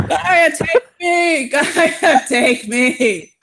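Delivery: hammed-up plea to Gaia, laughing; mock despair. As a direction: cry out dramatically to the goddess. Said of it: "Talia just shakes his head like".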